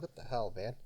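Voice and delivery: usual speaking voice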